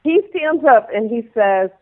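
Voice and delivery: usual speaking voice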